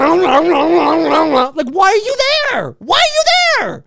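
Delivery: slow, then very high, loud disbelieving cry; a ranter's final outburst at moviegoers on phones; exasperated yell. Real, not acted. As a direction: stretch the yell out in utter disbelief. Ranting climax.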